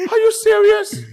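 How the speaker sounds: very high, laughing protest; hammed-up incredulous squeal; high-voiced disbelief. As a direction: pitch it way up as if shocked. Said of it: "when your voice gets high".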